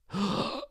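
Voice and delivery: sharp rising gasp, high; a sudden dramatic intake of breath; shocked gasp. Acted. Narrator reacting to the stabbing, sharp gasp. Chef stabs the queen.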